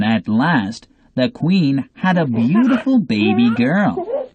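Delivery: ordinary delivery, nothing marked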